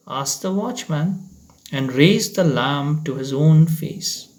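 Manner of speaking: ordinary delivery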